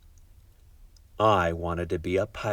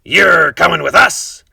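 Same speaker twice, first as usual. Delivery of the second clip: Braidbeard's order; 9 semitones higher, 12 dB louder, slower than usual. A narrator's reading. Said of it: "Braidbeard told me".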